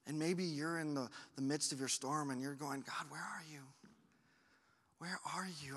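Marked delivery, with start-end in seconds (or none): 2.8-5.8 pleading prayer. Retold or imitated. Voicing a struggling listener's prayer.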